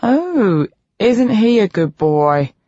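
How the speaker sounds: put-on high, slow sarcastic baby talk; mock coo. A narrator's reading. B mocking Rage to the doctor, sarcastic cooing. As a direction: coo it like praising a dog. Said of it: "I coo".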